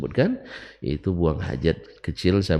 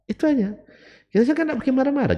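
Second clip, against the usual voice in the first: excited retelling; faster than usual. Real, not acted. Animated retelling of confrontation.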